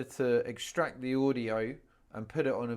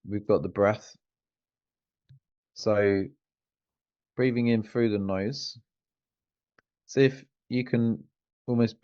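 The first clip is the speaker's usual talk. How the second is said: lower, relaxed meditation guide with long pauses; guided meditation; 4 semitones lower, 6 dB louder than usual. Real, not acted. Coach leading a client through a grounding meditation, guided meditation voice. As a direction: guide them down into the body, leaving room to feel.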